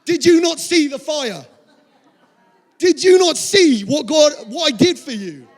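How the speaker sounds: high, loud, laughing exasperation at Elijah; half-laughing shout, like God losing patience; playful mock yell of disbelief; exasperated outburst. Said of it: "I'm probably gonna be going".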